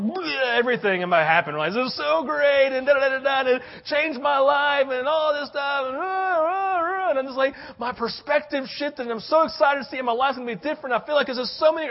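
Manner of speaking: high, loud, breathless gushing over the trip; gushing mission trip rave. Retold or imitated. Pastor mocking his own rant to a friend, high excited gush. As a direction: spill it all out like an overexcited returning missionary. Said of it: "the mission trip throw up".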